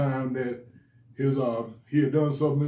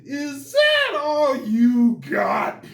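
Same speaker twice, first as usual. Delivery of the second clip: much higher, louder, very slow taunt; boy taunting his mom after a spanking; defiant taunt; 10 semitones higher, 5 dB louder, much slower than usual. Retold or imitated. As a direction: dare her to hit harder. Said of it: "I looked at her and I said".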